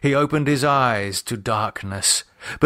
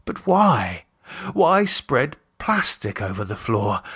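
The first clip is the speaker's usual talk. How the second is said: plain reading voice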